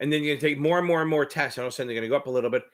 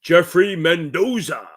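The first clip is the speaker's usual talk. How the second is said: slow, louder drawn-out ring announcer call; WWE ring announcer; 3 semitones higher, 6 dB louder, slower than usual. Shown as a demonstration. Announcing a viewer's name, wrestling announcer style. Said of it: "Gotta put that little w-, W W E stuff on it".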